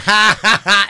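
loud, high jeering ha ha ha; mocking laugh. Crowd on the street mocking the cop, jeering laugh. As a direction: laugh in his face. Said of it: "laughed at his face".

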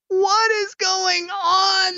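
high, slow squealed disbelief; mock outrage. Reacting to the announcer, high slow squealed wail.

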